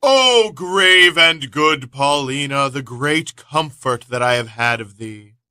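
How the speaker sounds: loud, slow Shakespearean declaiming; dramatic reading. Acted. [name] reads Leontes' lines aloud, loud and slow. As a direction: declaim it like a king on stage. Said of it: "[name] picks it up and reads".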